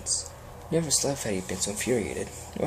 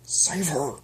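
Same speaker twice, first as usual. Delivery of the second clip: frantic strain; 5 semitones higher than usual. Acted. Straining to heal her.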